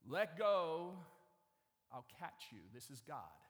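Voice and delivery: James Earl Jones. Acted. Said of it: "sounds just like James Earl Jones".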